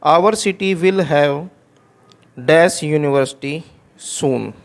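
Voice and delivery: very slow, word by word example sentence; teacher dictating slowly; slow dictation. Shown as a demonstration. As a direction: read it out slowly for students to copy. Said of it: "अब next sentence देखें".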